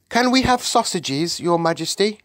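put-on high-pitched girlish request; eager little Sophie. Sophie asks the Queen for food, eager. Narrator voices hungry Sophie.

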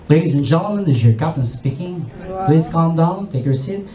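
captain calming the cabin over the intercom. Retold or imitated. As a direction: reassure the panicked passengers. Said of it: "sabi nyo".

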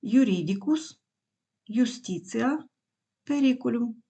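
"Юрідікус, юстіціа, перікулюм" stressed on the third syllable from the end; third-last syllable stress. Shown as a demonstration. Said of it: "Наголосу не буває далі третього складу".

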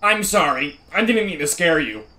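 a hint of an annoying young Sheldon. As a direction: play the whiny know-it-all boy. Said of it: "the most annoying young Sheldon voice ever".

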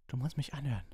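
high, strained croak; hoarse, urgent plea. A narrator's reading. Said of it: "krächzte er".

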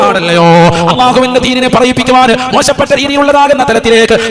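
loud, intoned sermon with long-held line endings; full-force preaching. Real, not acted. Preacher rebukes the community over social media, loud chanted exhortation. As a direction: preach at full volume, rising high and chanting the closing words.

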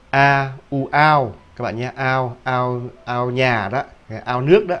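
the ao glide in now stretched out loud, like Vietnamese "ao" (pond); sounding out a vowel. Shown as a demonstration. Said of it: "không phải là au nhé".

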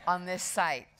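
ordinary delivery

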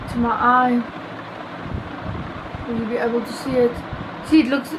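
ordinary talk, nothing marked

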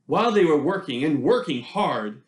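plain reading voice

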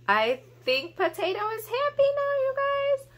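put-on thrilled at a big bubble for the kids; delighted squeal.

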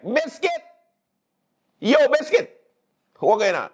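high, excited call across the street. Retold or imitated. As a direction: hail him like a friend far off. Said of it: "I'm going like".

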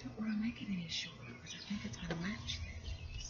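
whispered to herself; whispering. Real, not acted. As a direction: murmur it while concentrating. Trimming while talking quietly.

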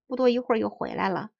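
ordinary delivery

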